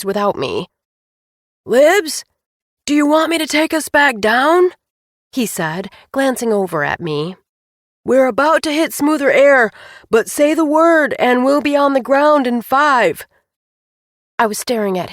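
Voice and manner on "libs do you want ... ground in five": shouting. A narrator's reading. Pilot calling over engine noise.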